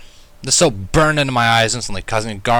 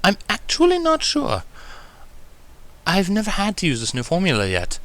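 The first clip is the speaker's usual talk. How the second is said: Rarity voice; 9 semitones higher than usual. Acted. Rarity admitting she never used the formula, high and refined. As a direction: answer with polite uncertainty. Rarity answering about gel.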